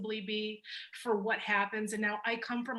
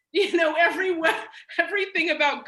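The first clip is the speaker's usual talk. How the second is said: louder and higher, laughter bursting through; real laughter, higher and louder; laughing; 7 semitones higher, 8 dB louder, faster than usual. Real, not acted. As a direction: laugh mid-sentence at how much you packed in. Laughing about the dossier contents.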